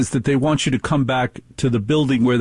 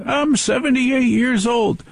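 imitating old caller; 11 semitones higher than usual.

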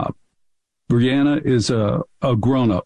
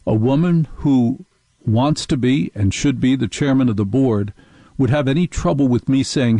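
usual speaking voice

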